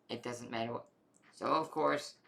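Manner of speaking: plain reading voice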